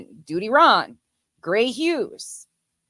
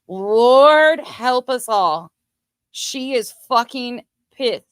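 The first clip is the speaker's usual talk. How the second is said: slow, drawn-out, raised-pitch exasperation; exasperated; 4 semitones higher, 7 dB louder, much slower than usual. Real, not acted. Host reacting to an angry woman's video, slow exasperation. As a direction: drag it out, starting loud.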